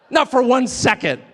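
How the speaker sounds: ordinary delivery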